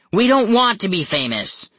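high, emphatic refusal; protesting. A narrator's reading. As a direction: object as a cornered kid. Captive protests to villains.

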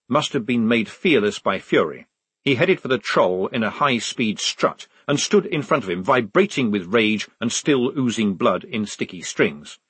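plain reading voice

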